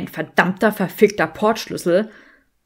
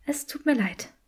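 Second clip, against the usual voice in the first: soft, higher-pitched whispery voice; whispered apology; 5 semitones higher, 6 dB quieter than usual. A narrator's reading.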